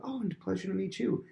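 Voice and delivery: high-pitched glad-to-meet-you; gushing politeness. Acted. Prince answering a commoner he just met, gushing politeness. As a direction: pitch it up, overly pleased.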